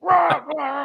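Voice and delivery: high, ranting shouted gibberish; hammed-up stand-up comic's angry rant noise; Bill Burr. Man losing his temper like a comedian, mock raging. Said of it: "I become Bill Burr on stage".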